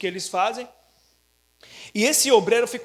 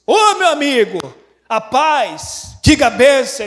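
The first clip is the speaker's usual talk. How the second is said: louder, eager hello on the phone; eager phone greeting; 4 semitones higher, 10 dB louder, slower than usual. Retold or imitated. Pastor answering the friend's call, loud and eager. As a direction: answer the call hoping for news.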